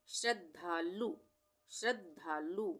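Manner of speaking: श्रद्धालु stretched into श्रद्-धा-लु; slow syllable-by-syllable dictation. Shown as a demonstration. Teacher showing the half द by ear, श्रद्धालु slowly split up. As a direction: sound out श्रद्धालु slowly, half द then full धा. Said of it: "पढ़ के बोल, बोल के देखेंगे".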